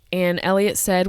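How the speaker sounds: ordinary talk, nothing marked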